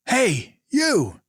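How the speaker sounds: high shouted call; yelling. Acted. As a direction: call out to the guard. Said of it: "Finn yelled".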